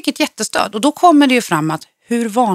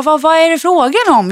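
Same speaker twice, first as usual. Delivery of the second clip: higher-pitched imitated regional Swedish dialect; funny Swedish dialect; 7 semitones higher, 4 dB louder than usual. Baffled classmate asks what is going on, in imitated funny dialect. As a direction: lightly mimic a dialect from elsewhere in Sweden. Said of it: "med rolig dialekt också från någon helt annanstans".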